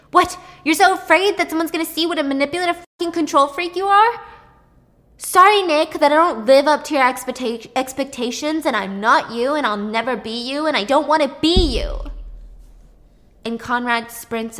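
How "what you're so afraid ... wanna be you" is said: louder, higher and fast, bitter; furious rant. Retold or imitated. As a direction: hurl the accusations at him. Brother yelling at Nick.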